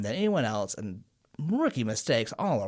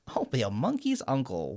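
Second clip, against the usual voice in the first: higher goofy playing voice; lightly voiced playful babysitter; mock silly uncle; 7 semitones higher than usual. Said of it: "he goes".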